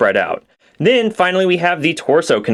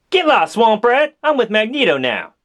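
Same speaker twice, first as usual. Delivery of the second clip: high, slow sassy rebuff; Rogue voice; 6 semitones higher, slower than usual. Acted. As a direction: brush him off, higher. Figures voiced in a skit.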